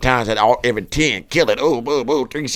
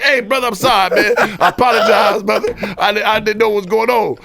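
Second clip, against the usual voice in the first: nervous apology; 8 semitones higher than usual. Retold or imitated. Apologizing to knife man.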